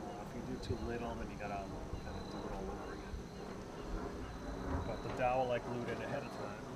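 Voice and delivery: muffled through a respirator mask; talking behind a respirator; through an N95 mask. Real, not acted. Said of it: "I'm gonna sand it with the respirator".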